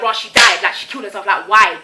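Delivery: loud, stunned gossiping voice; mock shocked gossip. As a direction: blurt it out like shocked onlookers. Imagined reaction to her death.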